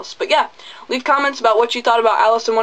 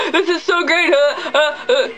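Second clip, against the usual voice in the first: high, laughing fangirl squeal; giddy scene kid; 7 semitones higher than usual. Retold or imitated. Scene kids gushing at him in the theater, high laughing squeal. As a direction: gush like a giggling fan. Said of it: "scene kids that were all like".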